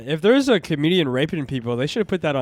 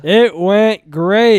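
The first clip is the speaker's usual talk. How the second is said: deadpan understatement; 3 semitones higher, 7 dB louder, much slower than usual. Real, not acted. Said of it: "You can tell from the tone of my voice".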